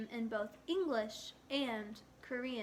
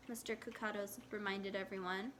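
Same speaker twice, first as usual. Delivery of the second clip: ordinary delivery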